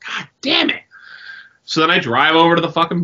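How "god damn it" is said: high, exasperated curse; hammed-up high-pitched damn it; mock exasperated curse. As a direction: blurt it out like your pants just split. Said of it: "I go".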